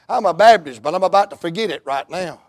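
usual speaking voice

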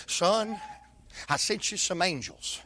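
low, soft, tender; tender voice of God. Acted. God tenderly tells Elijah he came himself. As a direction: speak gently like a loving father.

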